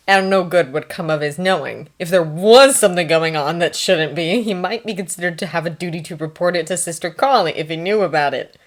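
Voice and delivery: usual speaking voice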